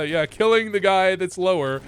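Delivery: ordinary talk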